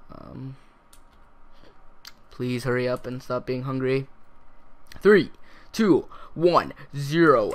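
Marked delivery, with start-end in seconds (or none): 5.0-7.5 loud countdown. Real, not acted. Countdown to eating.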